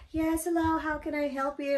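high-pitched customer service greeting; imitated phone agent. Coworker answering a customer's call, imitated agent greeting. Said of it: "Nikki answered the phone and she's like".

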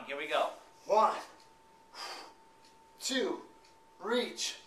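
ordinary talk, nothing marked

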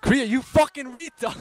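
angry yell. Real, not acted. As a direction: yell at him, outraged. Teamkilled, yells at Kriya.

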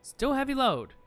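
high, loud outburst of disbelief; exasperated disbelief. Real, not acted. Gamer finds the gear still too heavy, in exasperated disbelief. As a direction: exclaim it in high-pitched disbelief. Same result after removing equipment.

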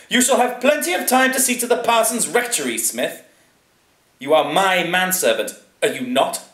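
very high, loud indignant aristocrat; pompous master putting his man in his place; imperious lord. A narrator's reading. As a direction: pull rank on the servant, high and loud. Plays Lord Chatterley in a story.

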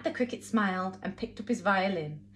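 ordinary delivery

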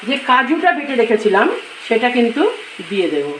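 ordinary talk

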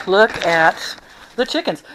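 ordinary talk, nothing marked

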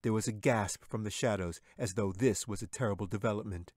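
plain reading voice

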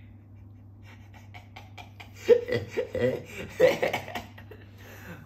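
long breathy fit of laughter; wheezy laugh. Real, not acted. Host reacting to harsh online comments, wheezy laugh. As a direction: laugh helplessly in spaced bursts.

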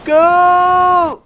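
high, loud, rising into a shout for help; panicked cries for help. Acted. Grandson falling into the flooded hole, screaming for help. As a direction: yell for help as you go under.

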